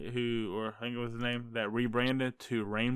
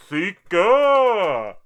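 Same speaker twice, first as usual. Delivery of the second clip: loud, high, drawn-out call of a name; hailing shout; 8 semitones higher, 13 dB louder than usual. Pretend. Host greeting a fellow creator on the tier list, hailing shout. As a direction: holler it out like a big hype greeting. Calling out next person's name.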